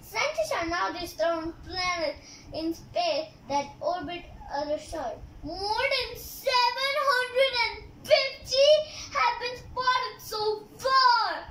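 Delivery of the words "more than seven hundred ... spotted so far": normal talking voice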